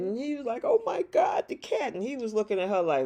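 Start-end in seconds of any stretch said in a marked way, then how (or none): 0.6-1.9 high-pitched imitation of grieving; hammed-up upset over a pet; mock distraught. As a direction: wail it lightly, pitch way up. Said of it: "Why are you so sad".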